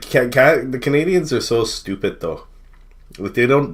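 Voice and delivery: normal talking voice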